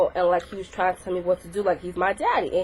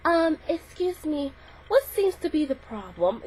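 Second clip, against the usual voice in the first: higher, slow, prim lady voice; prissy lunch lady; 5 semitones higher, 4 dB quieter, slower than usual. Retold or imitated. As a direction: put on a fussy adult with her coffee. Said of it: "She's like".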